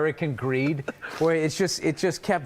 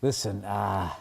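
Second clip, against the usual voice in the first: low, subdued fatherly admission; sheepish confession; 8 semitones lower, 5 dB quieter than usual. Acted. Dad breaking bad news to his child, sheepish and low. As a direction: confess it quietly, with awkward pauses. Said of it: "Were you just like".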